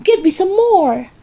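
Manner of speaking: very high, greedy demand; eager cry. A narrator's reading. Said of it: "she cried".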